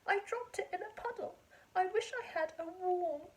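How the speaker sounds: crying fox. Acted. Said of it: "a fox who was crying".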